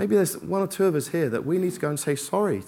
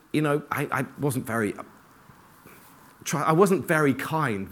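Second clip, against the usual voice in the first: ordinary delivery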